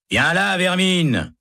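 high, slow contemptuous bark; harsh shout. A narrator's reading. A guard barking at Baca, high and slow. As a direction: order him out with contempt. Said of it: "crie un gardien".

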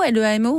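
LEAMO spelled letter by letter. Shown as a demonstration. Host checking a brand name, spelled out. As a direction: say L, E, A, M, O as separate letters. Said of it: "on dit Limo".

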